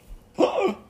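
high, loud hammed-up gasp; mock shocked gasp. Mock shock at a Canadian liking Team Russia, loud gasp. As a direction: gasp like you heard blasphemy.

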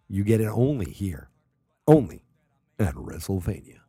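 loud hammed-up announcer push on only; mock announcer hype. Host hyping fake exclusive insight to listeners, hammed-up announcer. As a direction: sell it like a cheesy wrestling promo.

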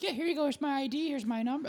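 high-pitched mock keenness; eager freshman. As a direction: play the good little freshman eagerly handing over ID. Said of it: "I was like, a good little freshman".

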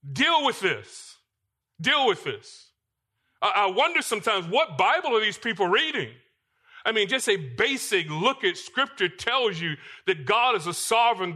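high-pitched, insistent preaching with punched key words; forceful preaching. Real, not acted. Preacher challenging the congregation over difficulty and pain, forceful. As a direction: preach it hard and high, driving each point home.

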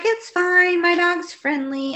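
mock a breezy dog owner, pitched high; high, drawn-out imitation of a carefree owner. Voicing a careless dog owner.

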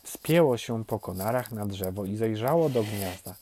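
usual speaking voice

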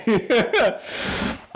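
short high-pitched laugh; real giggle at own joke. Real, not acted. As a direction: giggle high after a bit. Laughing after own impression.

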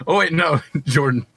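normal talking voice

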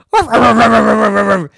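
loud yappy barks; loud dog barking. Acted. A neighbor's dog barking at the children, loud. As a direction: bark like a dog.

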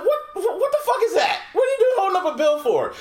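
high, laughing disbelief; incredulous. Retold or imitated. Reacting to Trump's ad, high laughing disbelief. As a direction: squeal it like you can't believe it.

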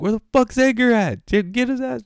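loud, high exasperated voice; retold urgent demand. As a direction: act out frantic impatience.

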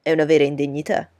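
loud, quick indignant outburst; exclaiming. Acted. Bingley protesting Darcy's charge, loud exclamation. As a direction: protest loudly and fast. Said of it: "esclamò Bingley".

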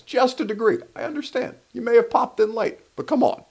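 high, softened pleading with a viewer; gentle chiding. Real, not acted. Host chides Ronald for popping in late, high coaxing voice.

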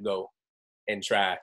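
ordinary talk

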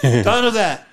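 loud mock warning; sarcastic. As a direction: play-act the scold, clearly joking. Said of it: "He's being sarcastic".

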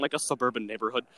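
usual speaking voice

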